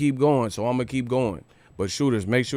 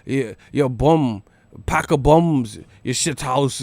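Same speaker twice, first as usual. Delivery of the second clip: put-on British voice, raised; mock British accent; 3 semitones higher than usual. Vlogger imitating British trash talk in a mock British accent. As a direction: sneer it like a London bloke. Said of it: "I've never heard someone say".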